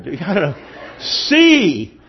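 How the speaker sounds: ordinary talk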